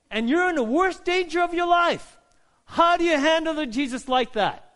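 high, loud, impassioned sermon; full-force preaching. Real, not acted. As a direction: build in volume and pitch to a crisis peak.

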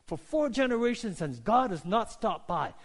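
ordinary talk, nothing marked

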